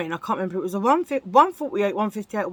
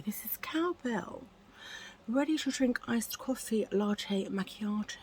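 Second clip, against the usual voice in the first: posh English accent; 2 semitones higher, 8 dB quieter than usual. Shown as a demonstration. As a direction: read the coffee label like a whispering posh lady. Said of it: "I'm gonna do it in a posh accent".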